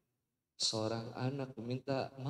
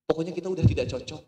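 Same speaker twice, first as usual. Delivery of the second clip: louder, fast blurted; stubborn insistence; 4 semitones higher, 10 dB louder, much faster than usual. Acted. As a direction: rush it out stubbornly. Couple insisting to ustaz.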